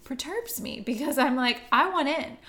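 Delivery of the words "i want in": higher, louder retold plea; insistent. Viewer wanting in on the characters' joke, insistent. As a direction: press to be included, mock frustration. Voicing own wish to share joke.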